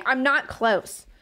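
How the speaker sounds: slowly stressing not; drawn-out I'm not close; slow insistence. Real, not acted. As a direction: insist each word, not dragged out. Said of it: "the strain in my voice".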